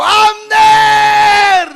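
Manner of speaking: loud, high call across the camp; David shouting at Abner; shouting a name. Acted. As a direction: yell the name to wake him. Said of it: "Le gritó a Abner y le dijo".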